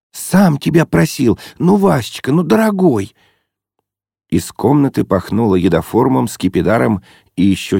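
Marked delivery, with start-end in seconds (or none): 0.1-3.2 put-on Zosya, coaxing girl. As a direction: plead sweetly with him, raised high. Zosya's voice, held high.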